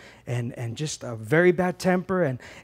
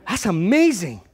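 amazed; 4 semitones higher, 3 dB louder, slower than usual. Retold or imitated.

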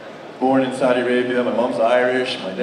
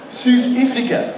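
put-on slow, high South African accent; 10 semitones higher, slower than usual. South African kid repeating his country, South African accent. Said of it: "I'm like".

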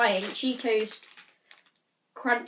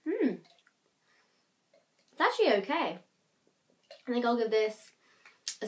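talking between sips; speaking with straw in mouth. Real, not acted. Seen 0: Straw from bottle at lips during most labelled words.

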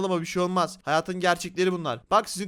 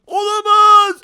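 high, very loud drawn-out cry; mock panic scream; 13 semitones higher, 14 dB louder, much slower than usual. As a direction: yell in playful horror. TNT about to blow up.

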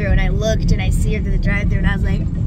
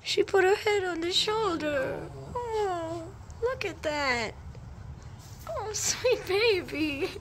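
tender cooing; 8 semitones higher, 9 dB quieter, slower than usual. Real, not acted. Cooing over a crying baby.

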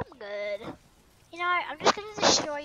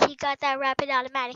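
normal talking voice

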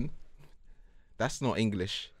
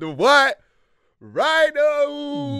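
hype announcement; 13 semitones higher, 4 dB louder, much slower than usual. Pretend. Hyping the nickname.

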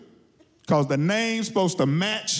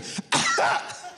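short dry hacking cough; acted coughing. Covid coughing in the quarantine room, acted cough. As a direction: cough as the sick virus in the corner. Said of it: "sitting in the corner coughing".

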